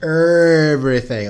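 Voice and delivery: drawn-out Saint Louis accent on everything. Shown as a demonstration. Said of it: "I'm using my Saint Louis accent".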